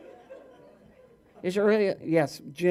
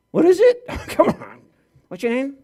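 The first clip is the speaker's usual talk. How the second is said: sudden high yell; loud high shout; 8 semitones higher, 9 dB louder than usual. Real, not acted. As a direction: yell it out, shrill with surprise. Shouts at the heckler.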